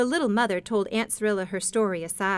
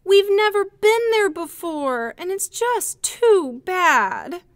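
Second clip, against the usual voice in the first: put-on high, louder child's voice, downcast; doleful little boy; 10 semitones higher, 7 dB louder, slower than usual. A narrator's reading. Oldest boy tells stranded travelers of missed Christmas, doleful child voice. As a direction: sound like a small boy let down.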